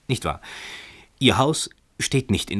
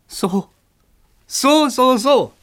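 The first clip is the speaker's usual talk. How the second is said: much higher and louder, sarcastic; mocking call; 6 dB louder, much slower than usual. A narrator's reading. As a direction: fling it up the stairs in scorn. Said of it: "rief Herr Emmerich hinauf".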